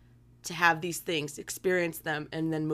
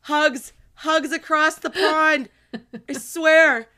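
higher and louder, slow and effusive; gushing hugs; 8 semitones higher, 7 dB louder, slower than usual. Real, not acted. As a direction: send the hugs across the ocean with feeling. Sending hugs to listener.

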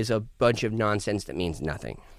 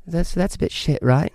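higher British questioning diss; British accent; 5 semitones higher, 4 dB louder than usual. Retold or imitated. Brit dissing you with a question, British accent. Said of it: "they'll just ask a question and diss you".

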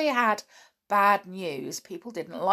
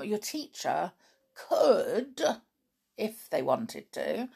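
usual speaking voice

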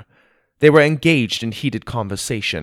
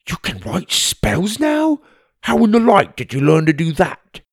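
higher, astonished friend voice; amazed Tim; 8 semitones higher, 4 dB louder than usual. Acted.

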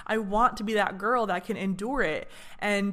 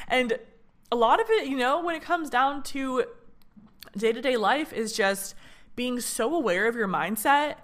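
ordinary talk

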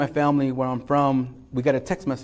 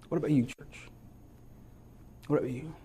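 soft, intimate preaching; hushed sermon close; 6 dB quieter than usual. Acted. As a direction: draw them in gently, quietly. Quiet altar-call intimacy.

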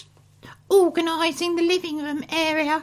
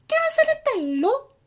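fake Italian; 7 semitones higher than usual. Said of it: "Italian gibberish".

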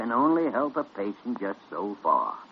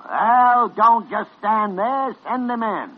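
louder, slow impatient command; 9 semitones higher, 7 dB louder, slower than usual. Acted. Senior doctor to the nurse, impatient. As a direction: snap an order at the nurse.

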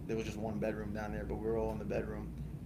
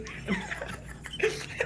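breathy high burst of laughter; 3 dB louder than usual. Real, not acted. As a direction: laugh at your own story. Laughing at own story.

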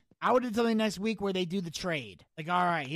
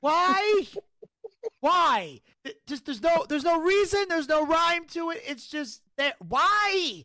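much higher, loud exasperated disbelief; incredulous rant; 9 semitones higher, 5 dB louder than usual. Real, not acted. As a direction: rage in disbelief at the bookers. Ranting at booking decision.

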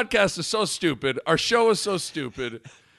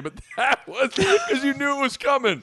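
ordinary talk, nothing marked

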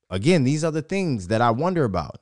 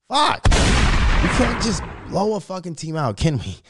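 high, loud exasperated yell, slowing down; frustrated outburst; 8 semitones higher, 7 dB louder, slower than usual. Real, not acted. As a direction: blow up at the team for not winning big. Frustrated fan over narrow win.

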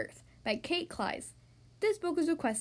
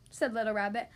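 plain reading voice

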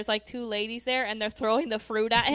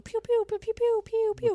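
high, rapid-fire pew noises; shooting sounds; 11 semitones higher than usual. Retold or imitated. Friend retelling them trying to shoot, high pew-pew noises. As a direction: imitate little shots, much higher. Imitating shots in a film scene.

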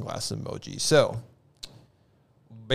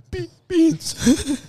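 much higher, stammered "beans" in mock shock. Acting out the shocked emoji, a high mock-scared stammer. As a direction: gasp out "Be-, beans." like a horror victim. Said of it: "the shocked face".

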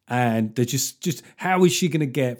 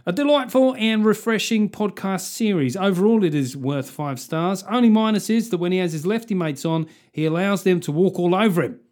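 higher-pitched mock Australian reading; Australian accent; 6 semitones higher, 3 dB louder than usual. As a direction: read it out as a put-on Australian. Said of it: "it just sounds Australian".

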